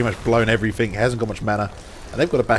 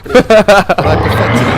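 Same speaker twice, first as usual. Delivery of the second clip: laughing; 6 semitones higher, 12 dB louder than usual. Real, not acted. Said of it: "make him do the nervous laugh".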